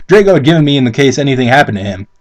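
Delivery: normal talking voice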